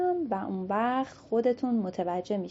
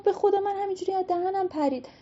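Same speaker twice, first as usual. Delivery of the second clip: higher, fast apology called out; pleading aloud; 10 semitones higher than usual. Acted. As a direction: beg forgiveness in a rush. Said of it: "بلند بهشون گفت".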